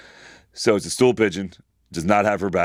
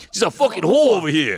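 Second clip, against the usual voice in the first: put-on high-pitched comic Italian-accented parrot; 8 semitones higher, 3 dB louder than usual. Host imagining the parrot talking, hammed-up Italian accent. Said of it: "Is it doing an Italian accent?".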